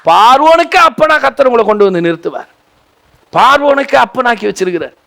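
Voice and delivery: full-force pulpit exhortation; fiery preaching. Acted. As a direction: exhort the crowd, pitch up.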